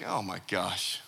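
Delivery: low, soft, deadpan aside; mock dismay. Preacher reacting to someone's petty offence, dismayed. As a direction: drop right down, quietly appalled.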